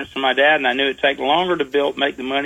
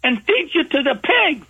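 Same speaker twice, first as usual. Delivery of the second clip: hammed-up old lady rant, pitched way up; a high-pitched mock granny tirade; granny screaming; 12 semitones higher than usual. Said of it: "screaming at him".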